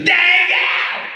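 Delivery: high-pitched holler; excited woman stranger. Retold or imitated. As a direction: mimic her piercing, stretched-out yell. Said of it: "She goes".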